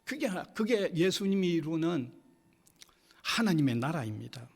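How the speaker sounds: usual speaking voice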